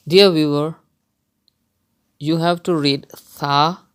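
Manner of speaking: normal talking voice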